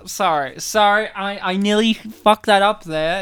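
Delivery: ordinary talk, nothing marked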